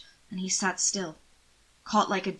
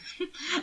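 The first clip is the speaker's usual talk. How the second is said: high, soft delighted laugh; laugh, thoroughly amused; 11 semitones higher, 5 dB quieter than usual. Acted. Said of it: "That made him laugh".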